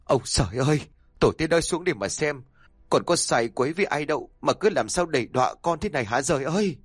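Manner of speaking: high, fast sobbing cry to heaven; sobbing lament. Acted. Kneeling husband crying out to heaven, sobbing. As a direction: wail to heaven, choking on sobs. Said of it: "khóc nấc lên từng tiếng nghẹn ngào".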